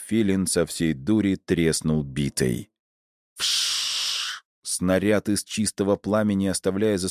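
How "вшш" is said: long voiceless rushing hiss; fireball whoosh. A narrator's reading. Narrator imitates the fireball flying off, hissed whoosh. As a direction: imitate a fireball shooting past.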